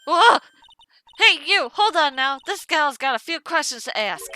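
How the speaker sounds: loud, high brash southern gal. Acted. A brash photographer hollering at the lawyer, high and loud. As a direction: shout it out like a pushy country reporter. Player voices Lotta Hart.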